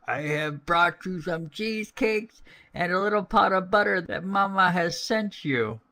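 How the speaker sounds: wolf imitating a little girl; wolf faking a girl. Wolf at the door, mock little girl voice. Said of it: "speaking like a little girl as well as he could".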